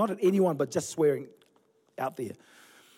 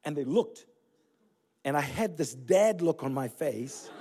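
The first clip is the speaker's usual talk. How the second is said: ordinary talk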